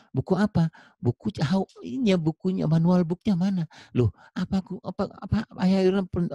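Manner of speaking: high-pitched, animated acted haggling; baffled back-and-forth. Acted argument over missing manual. (adu mulut pura-pura, nada tinggi)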